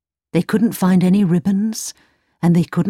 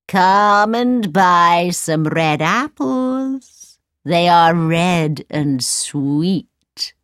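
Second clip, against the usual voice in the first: put-on old peddler woman; much slower than usual. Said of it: "she cried".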